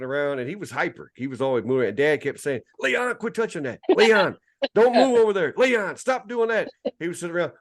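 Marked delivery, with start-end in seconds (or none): 2.8-6.7 put-on high, repeated scolding of a fidgety boy; nagging dad. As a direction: keep telling the kid off, name first each time. Dad scolding restless boy in church.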